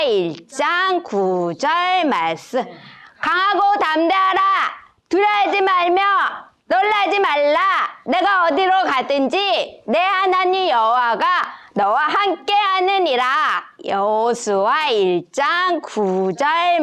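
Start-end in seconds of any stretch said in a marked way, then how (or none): 3.2-13.2 higher, cut-off bursts for each chunk; chunked, emphatic recitation; chopped phrase by phrase. Shown as a demonstration. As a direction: shout each phrase separately like a cheer. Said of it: "이렇게 끊어서 읽는 거예요. 마디마디마다".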